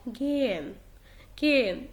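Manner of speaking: "جين" as gehen with the H almost gone; barely felt H. Shown as a demonstration. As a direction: glide over the H in "جين", hardly sounding it. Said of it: "ما بحسش بيه قوي". (نطق "جين" بهاء خفيفة تكاد لا تُسمع)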